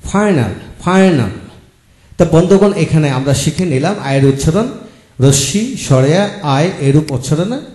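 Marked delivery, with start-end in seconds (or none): 0.1-1.3 ফাইনাল said slowly, each syllable drawn out as FAI-nal; slow, deliberate ফাইনাল with a clear long আই; careful pronunciation. Shown as a demonstration. Teacher to students, modelling ফাইনাল slowly and carefully. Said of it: "showing words with আই sound".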